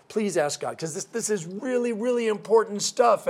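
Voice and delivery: high, pleading voice that climbs to the end; the rich man begs Saint Peter to ask God, pleading high. Acted. Rich man begs at heaven's gates.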